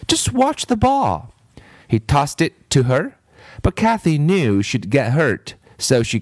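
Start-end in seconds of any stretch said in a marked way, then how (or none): 0.1-1.3 high, insistent reassurance; coaxing boy voice, pitched high; insisting boy. Acted. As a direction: urge her not to worry. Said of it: "Damon insisted".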